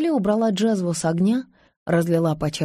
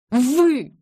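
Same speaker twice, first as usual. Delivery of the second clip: higher, sharp accusing cry; breathless outcry; 6 semitones higher, 4 dB louder than usual. A narrator's reading. Nazeli turns on her visitors, crying out in outrage. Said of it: "выкрикнула она, задыхаясь".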